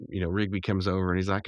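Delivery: ordinary talk, nothing marked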